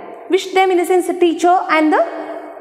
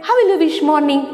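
ordinary talk

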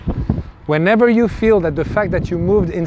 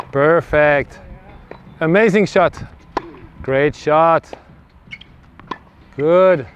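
usual speaking voice

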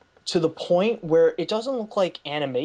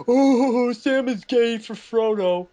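joking sing-out, pitched up; mock teasing; 5 semitones higher, 4 dB louder, slower than usual. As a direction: tease it like a running joke. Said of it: "you make all these jokes".

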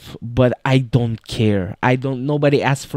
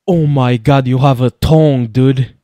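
louder, delighted oh my god; thrilled exclamation; 7 dB louder than usual. Real, not acted. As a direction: burst out in real excitement. Thrilled at game animation.

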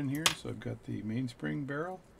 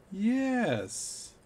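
Newfie answer; 10 semitones higher, 4 dB louder than usual. Retold or imitated. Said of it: "And the newfie says".

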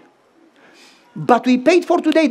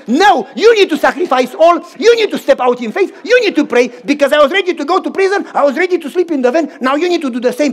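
louder, raised preaching push; firm exhortation; 4 semitones higher, 5 dB louder than usual. Retold or imitated. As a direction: press the point home hard. Preacher retells his firm rebuke.

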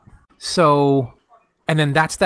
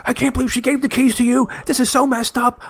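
high-pitched, hammed-up indignation; betrayed friend; 9 semitones higher than usual. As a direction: imitate a friend wailing about betrayal. Retelling betrayed friend's outraged complaint.

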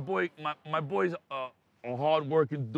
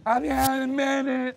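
higher, put-on voice to hide who is talking; disguised voice; 6 semitones higher than usual. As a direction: fake a different voice through the door. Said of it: "You get the voice changer".

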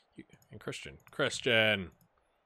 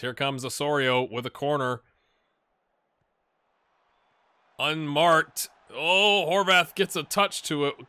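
louder, higher commentary; play-by-play; 4 semitones higher, 7 dB louder, slower than usual. Acted. Gamer narrating his team's corner kick, commentator voice. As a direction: call the corner like a broadcaster.

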